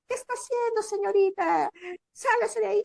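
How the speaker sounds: put-on high, loud shout at the girl; retold yell. Worker yelling at a girl in the bull pen, high loud shout. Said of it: "voltea y me grita".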